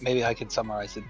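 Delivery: normal talking voice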